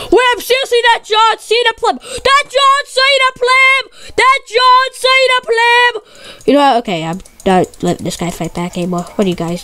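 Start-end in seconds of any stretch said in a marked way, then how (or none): 0.1-6.5 high, slow taunting sing-song; mocking. Gamer mocking an opponent he calls a pleb, taunting. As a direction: taunt the rival player.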